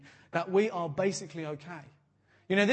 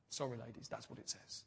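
hushed sheepish aside, much softer; apologetic whisper aimed at the ladies; whispered apology; 8 semitones lower, 16 dB quieter than usual. Real, not acted. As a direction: drop to a whisper, half-embarrassed. Sheepish aside to women present.

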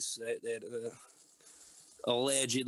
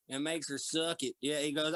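ordinary delivery

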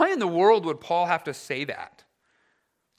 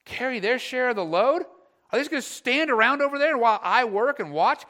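higher, louder self-righteous outrage; hammed-up bitter complaint; mock indignation; 7 semitones higher, 5 dB louder than usual. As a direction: huff about the lazy ones. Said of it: "we get so".

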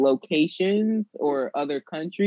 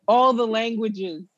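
eager demanding wish; 5 semitones higher than usual. Retold or imitated. Mock demanding genie wish.

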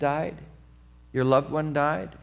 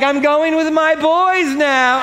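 high, loud and slow, triumphant; gleeful announcement; 10 semitones higher, 6 dB louder, slower than usual. Retold or imitated. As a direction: crow it from the stretcher. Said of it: "and she's like".

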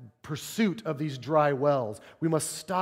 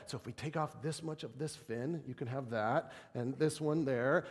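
much softer, mumbling calculations to yourself; frantic muttering; 10 dB quieter than usual. Retold or imitated. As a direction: work out the pieces under your breath. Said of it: "we frantically try to solve it".

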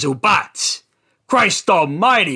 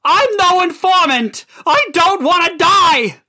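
high, frantic denial; terrified high plea; desperate pleading; 12 semitones higher, 4 dB louder than usual. Acted. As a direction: plead for your life. Begging victim under torture.

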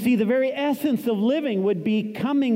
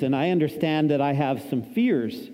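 ordinary talk

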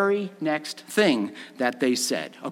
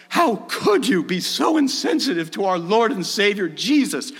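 mock pious indignation; 4 semitones higher, 3 dB louder than usual. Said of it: "That is not how they were indignant".

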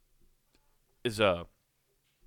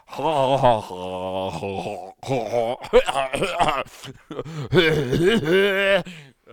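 long loud burst of real laughter; big laugh; 6 semitones higher, 10 dB louder than usual. Real, not acted. Host cracking up at his own gag noises, laughing loudly. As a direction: crack up hard at your own bit.

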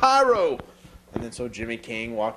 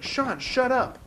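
mock snap; 12 semitones higher, slower than usual. Gordy snapping at Sean.

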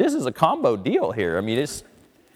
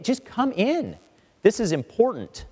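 higher, more insistent plea; urgent pleading father; 9 semitones higher than usual. Retold or imitated. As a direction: beg him now, voice lifted high. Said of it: "the father pleads with him and says".

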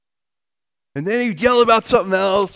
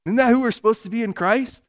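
ordinary talk, nothing marked

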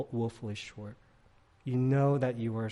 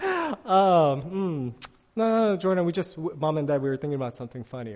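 high, laughing cover-up excuse; stifled laughter; 4 semitones higher, 7 dB louder than usual. Retold or imitated. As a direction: hide your laughter while making an excuse. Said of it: "We're like".

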